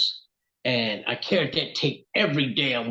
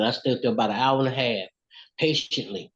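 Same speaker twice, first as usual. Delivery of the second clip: ordinary talk, nothing marked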